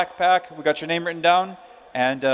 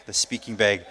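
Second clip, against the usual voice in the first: much lower, softer aside; low amused mutter; 10 semitones lower, 5 dB quieter than usual. Real, not acted. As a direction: mutter the slip to yourself, low. Repeats own slip to himself.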